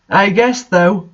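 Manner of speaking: normal talking voice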